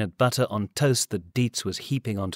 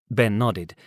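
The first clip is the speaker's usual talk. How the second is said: plain reading voice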